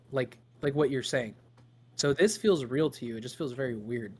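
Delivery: ordinary delivery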